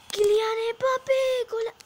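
high, loud, stretched goal call; sports commentator calling the goal; excited commentary. Acted.

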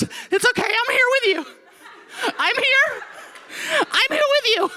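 very high, squealed reassurance; shrieky mock-panicked comfort; panicked sister. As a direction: imitate someone comforting while terrified. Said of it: "picks up my panic".

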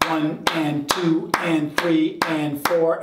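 slow, even counting of eighth notes. Shown as a demonstration. Drum teacher counting eighths to the student, slow and even. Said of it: "and we cut that in half".

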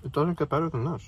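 eating in car; talking while chewing. Real, not acted. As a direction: mouth partly full. Seen 1: Bites wrap just before; jaw chewing, lips closed through most words.

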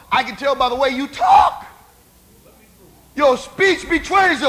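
high, loud accusation; preacher acting out an accuser; accusing shout. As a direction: call him out in front of everyone. Woman accuses Peter by his speech.

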